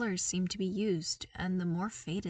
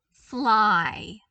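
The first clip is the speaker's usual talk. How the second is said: Fly punched much louder; Fly said as a loud, firm correction; emphatic correction; 11 dB louder than usual. A narrator's reading. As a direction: hit Fly hard, correcting yourself.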